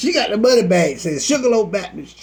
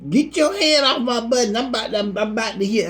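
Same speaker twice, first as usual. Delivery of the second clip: high, indignant protest; indignant gambler; 6 semitones higher than usual. Acted. Gambler warding her pastor off the machine, indignant. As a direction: pitch it up, urgent and protective.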